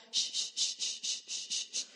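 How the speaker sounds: a string of short airy shushes; imitated Argentine chatter. Retelling what she heard at the airport, imitated shushing. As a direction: hiss repeated "shh" like overheard Argentine speech. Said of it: "lo que escuchaba constantemente era".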